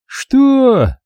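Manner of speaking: high startled what. A narrator's reading. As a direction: react sharply. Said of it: "живо отреагировал он".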